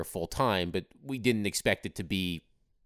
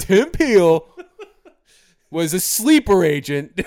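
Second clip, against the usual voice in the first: high, loud laughing conspiracy voice; whisper-shout a conspiracy, laughing; that silly voice; 8 semitones higher, 11 dB louder, slower than usual. Pretend. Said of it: "blame the referees in that voice".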